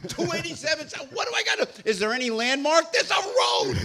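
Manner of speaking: high, loud, incredulous ranting; exasperated rant. Acted. Stranded driver argues with roadside assistance, high exasperated rant. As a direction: argue at a high, strained pitch with the useless operator. Acted quarrel with roadside operator.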